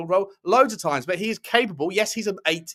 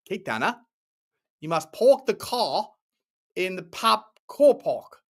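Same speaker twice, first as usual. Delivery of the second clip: slow, badly put-on South African accent; hammed-up South African accent, drawn out slowly; terrible South African accent; 3 semitones higher, slower than usual. Shown as a demonstration. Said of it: "Terrible accent".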